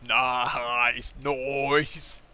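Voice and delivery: drawn-out, put-on "nice"; awkward mock approval. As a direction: stretch each word in a goofy approving voice. Awkward retold reaction to the confession.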